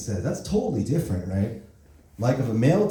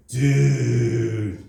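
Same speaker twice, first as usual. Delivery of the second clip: slow dude; 3 dB louder than usual. Shown as a demonstration. Scolding a friend who messed up, slow disappointed "Dude". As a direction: stretch "Dude" slowly in disbelief. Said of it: "if you say it really slow".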